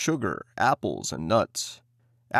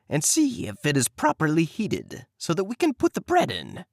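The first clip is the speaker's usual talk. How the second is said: higher coaxing witch voice; sly witch; 6 semitones higher than usual. Acted. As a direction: lure the girl toward the oven. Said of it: "said the witch".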